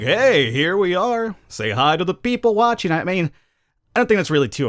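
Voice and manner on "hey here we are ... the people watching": higher, slow, hammed-up host voice calling out to viewers; mock streamer greeting. Vlogger mocks a studio camera greeting the viewers, hammed-up and slow. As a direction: play a cheesy stream host saying hello, lightly mocking. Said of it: "a constant camera being like".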